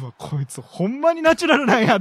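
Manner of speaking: amazed inner thought. Retold or imitated. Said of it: "と思って".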